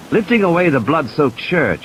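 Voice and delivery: ordinary delivery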